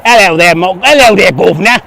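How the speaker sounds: hammed-up cockney-style British greeting; British accent. Shown as a demonstration. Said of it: "What's about a British accent?".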